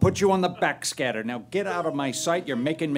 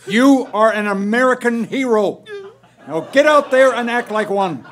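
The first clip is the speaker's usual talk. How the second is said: fed-up barked instructions; 4 semitones higher, 7 dB louder than usual. Acted. Supervisor barks orders.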